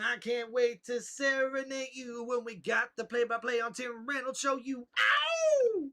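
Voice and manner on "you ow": high-pitched "ow!" yelp. Pretend. Song ends with a yell.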